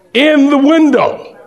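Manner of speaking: put-on high, slow, drawn-out child's plea; a kid set on the dream bike; little boy. Son telling his dad the bike he wants, high and drawn out.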